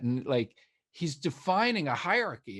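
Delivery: usual speaking voice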